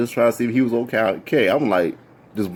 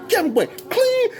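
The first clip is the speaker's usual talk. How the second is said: a very high, shrieking fan cry; fangirl scream. Retold or imitated. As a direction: scream like a starstruck groupie. Fan screaming on seeing stars.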